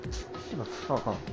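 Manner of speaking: ordinary talk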